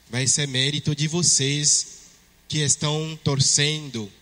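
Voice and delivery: ordinary talk, nothing marked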